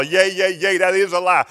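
loud hollered objection; preacher's holler. Acted. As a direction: holler it out from the pulpit. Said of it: "stand up and holler".

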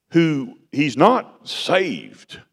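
ordinary talk

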